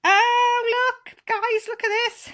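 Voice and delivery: high, loud delighted squeal; thrilled, showing off the gift; excited squeal. Real, not acted. Squealing to viewers over the new camera.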